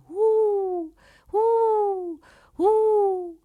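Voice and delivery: high hooting owl calls. A narrator's reading. The owl calling over and over at the shepherd, high owl hoot. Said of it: "chiamava continuamente".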